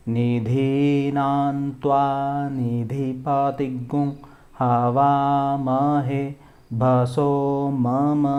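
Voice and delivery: slow Vedic chant, long held syllables; mantra recitation. Shown as a demonstration. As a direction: intone it steadily on one level note. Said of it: "आपको ये बोल के सुनाता हूँ".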